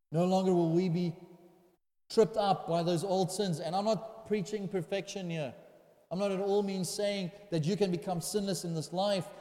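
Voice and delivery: driving, emphatic sermon delivery; impassioned preaching. Real, not acted. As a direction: preach it with growing conviction. Preaches at full force.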